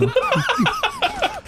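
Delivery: shrieking laugh at his own joke; high cackling laugh. Real, not acted. Friend cracks up at the dom joke, high cackle. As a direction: burst into a high-pitched cackle.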